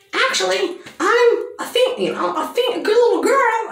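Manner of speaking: high, sing-song little girl voice, hammed up; mock little girl voice. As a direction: squeak it like a prissy little girl. Mocking the mouse girl's voice.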